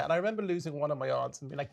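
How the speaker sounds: ordinary talk, nothing marked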